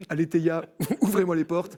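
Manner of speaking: laughing. Pretend. Joking about a TV miracle.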